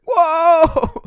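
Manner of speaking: loud high yell in the car; pumped-up shout. Retold or imitated. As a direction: let it rip, blood pumping. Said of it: "I was in my car and I was like".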